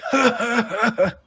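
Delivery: high, harsh wheezing cackle; grating wheeze laugh. Acted. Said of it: "it's like a grating wheeze".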